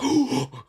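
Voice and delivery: high surprised mock gasp; sharp high imitated gasp. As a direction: act out her startled intake of breath. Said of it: "she's like".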